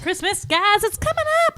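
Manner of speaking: very high, playful call-out; excited announcing. Real, not acted. Podcast host hyping Christmas to friends, in a high excited call. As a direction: sing it out high to the group.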